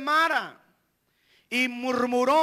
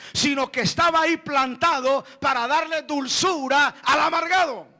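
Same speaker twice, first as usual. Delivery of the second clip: loud, higher, driving sermon climax; full-force preaching; 5 semitones higher, 6 dB louder than usual. Acted. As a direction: preach it hard to the congregation. Predicación a plena fuerza. (predicación con toda la fuerza)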